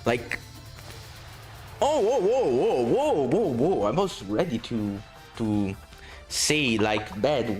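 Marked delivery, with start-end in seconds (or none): none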